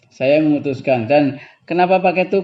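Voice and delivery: ordinary delivery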